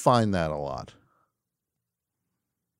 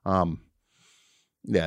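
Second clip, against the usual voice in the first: normal talking voice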